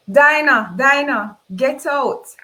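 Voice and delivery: very loud, high yell to throw her out; a hosts' very loud, furious yell at the maid; furious shout. Acted. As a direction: scream her name and order her gone. Chaotic fight, ordering her out.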